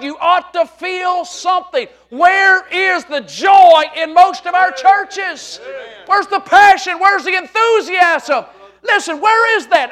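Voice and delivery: high, loud, fervent challenge; full-force preaching. Real, not acted. Preacher demands passion from the churches, at full force. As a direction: thunder it at the congregation, stretching key words.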